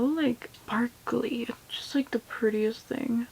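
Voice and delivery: breathless whispered gush; hushed amazement; awed whisper. Real, not acted. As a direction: whisper it in awe of the color. Gushing over eyeshadow.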